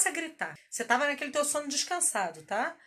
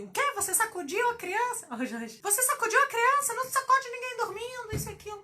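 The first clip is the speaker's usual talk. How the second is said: high, shrill-free mock outrage, pitched up; mock outraged busybody mother; mocking scolding mom; 11 semitones higher, 3 dB louder than usual. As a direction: imitate a judgy mom scolding, voice jumped high. Judging mothers scolding her.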